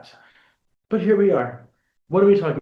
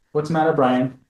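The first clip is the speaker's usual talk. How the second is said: ordinary talk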